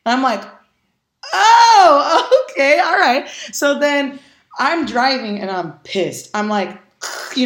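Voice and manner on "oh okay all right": high, loud startled laugh through the words; surprised laugh. Retold or imitated. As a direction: caught off guard, laughing it off. Surprised he replied at once.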